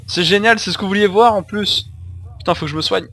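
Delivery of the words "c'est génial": ordinary delivery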